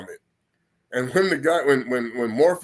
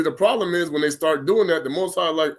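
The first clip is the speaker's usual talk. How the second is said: usual speaking voice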